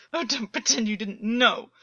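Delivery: choking bitter reproach; choked. A narrator's reading. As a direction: force it out through a tight throat. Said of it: "August choked".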